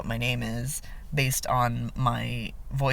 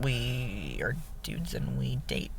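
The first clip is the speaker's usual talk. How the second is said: softer, slower, half-whispered point; dropped to a whisper; 2 semitones lower, 10 dB quieter than usual. Retold or imitated. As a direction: hush it like it's obvious. Spelling it out to mom.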